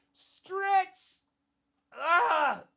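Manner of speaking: high strained stretch cry; stretching yelp. Real, not acted. Taking a break from recording, a high stretching yelp. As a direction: let out a squeak as you stretch. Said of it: "stretching with a cry".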